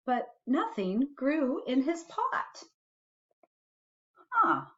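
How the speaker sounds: put-on gentle read-aloud for a child; reading a bedtime story to a little one; storybook reading. A narrator's reading. Parent reading a picture book to a child, gentle storytelling.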